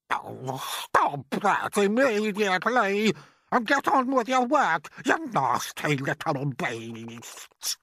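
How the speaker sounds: high, loud aunt barking orders; bossy order. Acted. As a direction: order the boy back to work, scornful. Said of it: "Aunt Sponge ordered".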